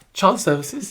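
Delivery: high, menacing mock-mum "Child services?". Mum daring her son to call them. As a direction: echo it back like a mum daring you. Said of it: "the most menacing reaction".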